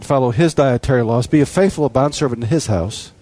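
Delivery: ordinary delivery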